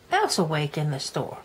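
ordinary delivery

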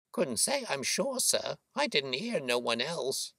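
high-pitched working woman's voice; boatman's wife. Acted. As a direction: answer respectfully in a high female voice. Mrs Smith answers Holmes.

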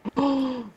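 sharp, high intake of breath; sudden gasp. Real, not acted. Player caught out mid-game, sudden gasp. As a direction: gasp in alarm.

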